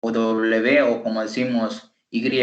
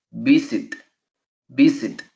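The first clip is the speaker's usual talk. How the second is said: visit, stressed on VIS; stress on first syllable. Shown as a demonstration. Teacher models visit for students, first-syllable stress. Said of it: "La fuerza de voz está en la primera sílaba".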